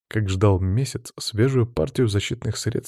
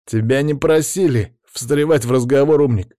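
loud, contemptuous jab; louder scornful put-down; sneering; 5 semitones higher, 5 dB louder than usual. A narrator's reading. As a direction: pull a face and sneer. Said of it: "скорчил физиономию Павел".

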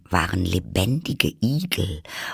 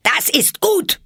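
very high, loud imperious shout; screamed; 6 dB louder, slower than usual. A narrator's reading. Queen satisfied with the soldiers' report, screaming. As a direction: scream your approval. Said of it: "schrie die Königin".

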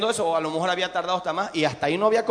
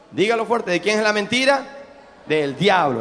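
ordinary talk

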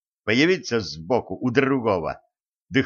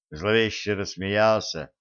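plain reading voice

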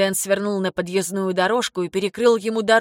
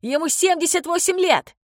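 high-pitched outraged cry; indignant protest; 9 semitones higher than usual. A narrator's reading. Son protests his father's arrest.

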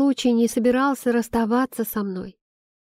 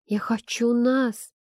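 ordinary delivery, nothing marked

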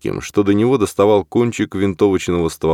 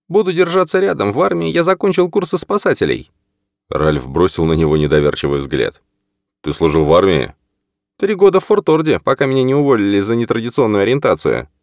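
raised, lighter male character voice; Norman voice; 7 semitones higher than usual. Acted. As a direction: voice Norman higher than the narrator. Norman's lines in the story.

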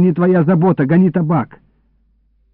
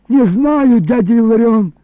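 high, louder pleading denial; frightened plea; 7 semitones higher, 4 dB louder than usual. A narrator's reading. Boy to threatening uncle, frightened plea. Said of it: "взмолился я дрожащим голосом".

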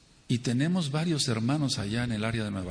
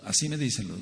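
normal talking voice

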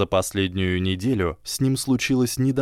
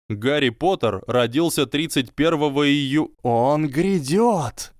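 booming prophecy voice; 6 semitones higher, 3 dB louder than usual. A narrator's reading. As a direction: boom out like an ethereal oracle. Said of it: "прогремел гулкий голос".

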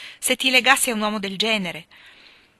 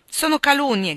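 a raised, higher protest; cried out; 7 semitones higher, 4 dB louder than usual. A narrator's reading. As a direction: insist loudly that it's slander.

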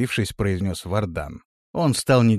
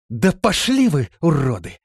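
loud, indignant outburst, high at the start; angry; 5 semitones higher, 5 dB louder than usual. A narrator's reading. Woman in the well refusing the men's demand, angry outburst. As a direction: curse them off angrily. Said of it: "разозлилась Биота".

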